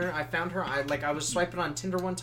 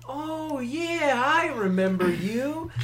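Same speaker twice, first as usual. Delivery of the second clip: high, slow mock girl voice recalling him; imitating his ex; 9 semitones higher, much slower than usual. Ex-girlfriend recognizing him at the bar, high drawn-out mock voice. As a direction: pitch it up and drag it out like a girly recognition.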